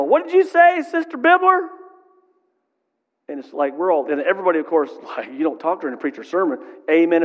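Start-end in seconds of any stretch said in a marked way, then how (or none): 0.0-1.7 high, loud cry across the church; yelling old lady. Retold or imitated. Old lady yelling at the preacher mid-sermon, high and loud. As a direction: shout it out like a hard-of-hearing old woman. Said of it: "and yell at my grandma".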